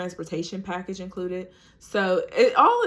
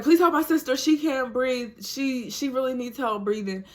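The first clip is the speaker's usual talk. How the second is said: urgent plea; 6 semitones higher than usual. Retold or imitated. Sister begging the nurse, high and urgent. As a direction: beg the nurse to help. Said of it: "she was like".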